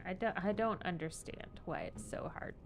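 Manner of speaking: normal talking voice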